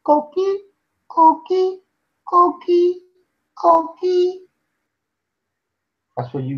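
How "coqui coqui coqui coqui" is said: very high chirping frog call; coqui frog call. Retold or imitated. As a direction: chirp it like a tiny tree frog. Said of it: "like they say".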